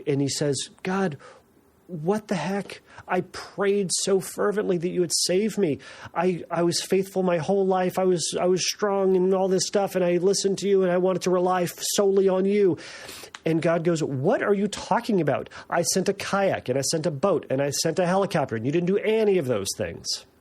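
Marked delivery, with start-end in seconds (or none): 0.8-12.8 indignant complaint. Retold or imitated. Man complains to God in heaven. 14.0-20.3 exasperated God. Retold or imitated.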